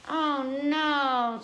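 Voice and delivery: alarmed at the rain; dismayed oh no. Acted. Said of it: "said Mommy Pig".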